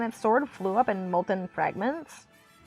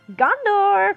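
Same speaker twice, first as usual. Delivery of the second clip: high, loud rallying cry; cried battle cry; 10 semitones higher, 5 dB louder than usual. A narrator's reading. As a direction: ring it out far above the narration. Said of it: "cried Boromir".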